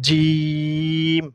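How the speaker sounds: জিম very slow, long level ee; demonstrated pronunciation. Shown as a demonstration. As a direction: stretch জিম out on one steady note. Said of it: "এইভাবে কি করতে হবে?". (জিম লম্বা করে টেনে)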